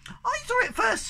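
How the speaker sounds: very high squeak; squeaky. Acted. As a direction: squeak it out like a small creature. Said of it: "squeaked Sniff".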